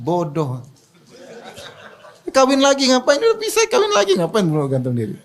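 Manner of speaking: mock scolding, pitch jumping up on kawin lagi, laughing through it; scoffing with a laugh. Preacher retelling how he scolded a man who hanged himself, laughing mock scold. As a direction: tease the fool as you laugh, high and incredulous. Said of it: "Saya bilang".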